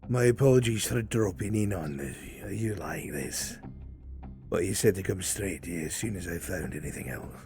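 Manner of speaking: lightly voiced guard, apologetic report; guard contact. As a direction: apologise as a guard dropping in on a superior.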